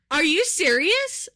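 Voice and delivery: slow, mock-stern incredulity; incredulous cop. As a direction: imitate a cop who can't believe it. Retelling cop's incredulous challenge.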